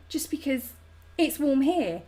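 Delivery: normal talking voice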